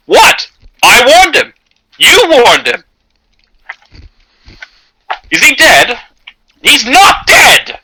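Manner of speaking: high, indignant captain protesting he warned him; defensive captain. Acted. GM voicing Captain Sartoris defending the shot, indignant. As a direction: play the shrugging captain, all wounded innocence.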